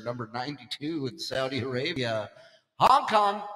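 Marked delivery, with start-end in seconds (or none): 2.8-3.4 high, loud, savoring the name; relished call-out. Acted. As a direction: shout the name just for fun. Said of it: "I just like saying it".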